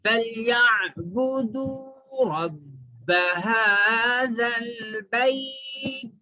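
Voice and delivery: slow drawn-out melodic chant; Quranic recitation. Shown as a demonstration. Teacher recites to students, slow tajweed chant. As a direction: recite it slowly with long held vowels.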